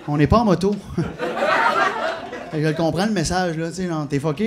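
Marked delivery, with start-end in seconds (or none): 0.8-2.5 high breathy giggle; a short high laugh at his own joke. Real, not acted. Comedian laughing at his own punchline.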